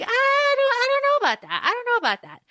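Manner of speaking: squeaky sarcastic skepticism; mock doubtful.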